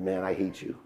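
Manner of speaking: very soft, low retort; retold hurt, dropped low and hushed; quiet bitterness. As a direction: say it under your breath, bitterly. Retold bitter reply to father.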